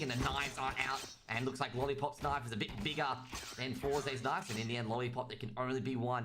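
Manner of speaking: hammed-up Aussie commentator accent; broad, exaggerated Aussie accent put on for fun; stronger Australian accent. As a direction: lay the Australian accent on thick. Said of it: "put on a stronger accent".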